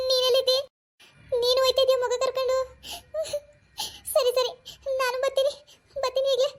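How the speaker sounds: a woman hearing of a death on the phone; worried woman on the phone. Acted.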